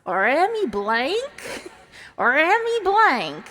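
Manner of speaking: mock a Southern accent, teasing the name; bad Southern accent. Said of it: "really bad Southern accent".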